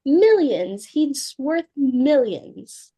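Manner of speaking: loud, then slowed and savoured; a mother dazzled by a rich suitor; gushing. Acted. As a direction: relish every syllable of the money.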